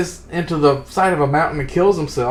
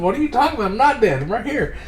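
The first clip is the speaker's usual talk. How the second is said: high-pitched mock exasperation; exasperated protest; 9 semitones higher than usual. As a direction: protest in high disbelief, half laughing. Acted protest at being called dead.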